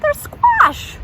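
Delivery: very high, loud squeaky answer; silly high voice. Acted. Reading to kids, revealing the vegetable in a squeaky voice. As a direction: pop it out like a cartoon character.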